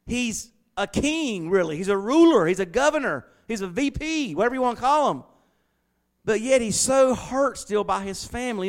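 loud, rising preacher's cry; fiery preaching. Acted. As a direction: preach it out to the congregation. Preaching at full force.

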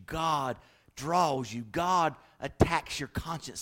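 normal talking voice

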